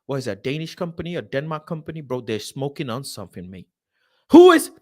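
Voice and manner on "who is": sudden yell. Real, not acted. Sudden loud outburst.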